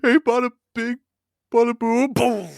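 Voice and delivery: high put-on mob guy cheer; mobster voice. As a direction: crow it like a wiseguy after a hit. Gangster hit celebration.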